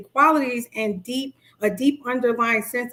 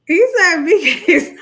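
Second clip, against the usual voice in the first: laughing; 8 semitones higher, 7 dB louder than usual. Real, not acted. Laughing at a viewer comment.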